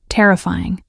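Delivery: ordinary delivery, nothing marked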